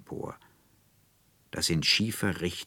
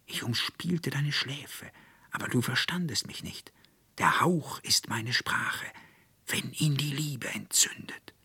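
soft, higher, breathy whisper; whispering elder bush; 7 semitones higher, 3 dB quieter than usual. Acted. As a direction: whisper it like rustling leaves. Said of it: "Der Holunderbusch rührte sich und sprach".

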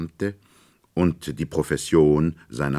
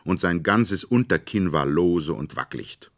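usual speaking voice

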